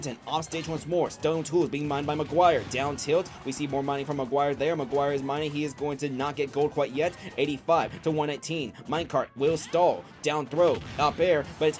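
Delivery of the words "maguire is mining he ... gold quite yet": usual speaking voice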